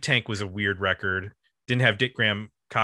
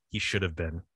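quiet retold aside; hushed; 4 semitones lower, 6 dB quieter than usual. Wife answering a friend about her husband, quietly hushed. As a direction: drop the voice for the remark. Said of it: "said it in a hushed tone".

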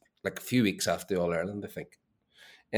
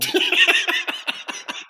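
high laugh; 7 dB louder than usual. Real, not acted. Laughing at the brawl story.

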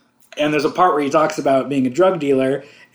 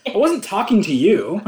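raised, laughing movie put-down; 5 semitones higher, 4 dB louder than usual. Acted. Repeating the best put-down ever, mock snub. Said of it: "just the best put down ever".

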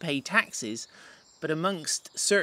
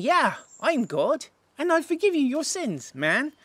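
casual bold Jesus; 11 semitones higher than usual. Acted. Paraphrases Jesus's bold claim as casual speech.

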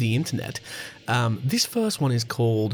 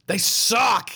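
shouting; 7 semitones higher than usual. Acted. Mock outrage at neighbours.

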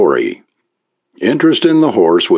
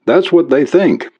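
higher-pitched, restrained yell; 8 semitones higher than usual. A narrator's reading. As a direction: call back in a mild, raised-pitch yell. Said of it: "Larry yelled".

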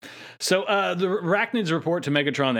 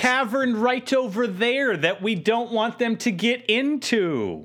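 mock villain; 8 semitones higher, slower than usual. Voicing Tarantulas' loud orders.

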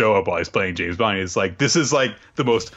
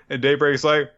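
normal talking voice

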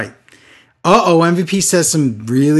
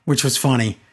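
usual speaking voice

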